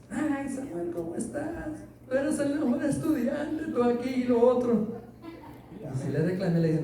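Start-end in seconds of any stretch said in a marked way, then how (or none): 0.1-4.9 imitating the gushing teacher. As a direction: gush over him like the old teacher. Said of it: "la encontré en Los Ángeles". (imitando a la maestra, aguda y melosa)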